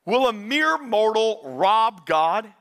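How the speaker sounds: slow, weighty, booming God voice; intense voice of God. Acted. Preacher voicing God to the congregation, slow and weighty. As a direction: speak as God, slow and heavy. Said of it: "it starts really intense".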